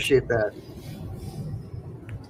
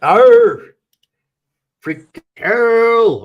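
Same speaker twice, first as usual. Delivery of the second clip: recruit roar; 8 semitones higher, 11 dB louder, much slower than usual. Acted. Drill sergeant scolding, recruit roars.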